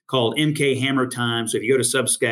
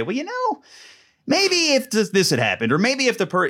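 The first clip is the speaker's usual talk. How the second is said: hesitant panelist voice; 11 semitones higher than usual. Retold or imitated.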